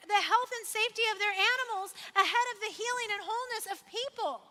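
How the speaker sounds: usual speaking voice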